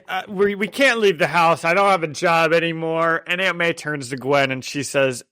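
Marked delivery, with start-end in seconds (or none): 0.1-3.2 a put-on whiny voice, a bit higher; hammed-up moping; mock whiny complaint. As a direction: whine it like a stuck-at-home relative. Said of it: "so they're like".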